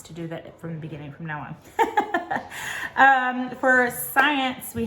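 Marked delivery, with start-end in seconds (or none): 1.6-2.9 breathy, high-pitched chuckle; laugh. Real, not acted. Teacher apologizing for a mistake, high breathy laugh. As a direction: laugh lightly at your own slip.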